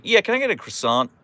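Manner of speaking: high, casual American order with "croissant" as kruh-SAHNT; ordinary American ordering. Acted. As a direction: order casually, no French at all. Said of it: "I say".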